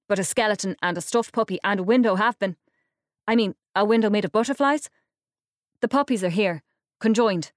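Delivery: fast and loud, tumbling over itself; nervous babble. A narrator's reading. Silas babbling to Iris about his exhibits, fast and loud. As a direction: gush to keep her listening.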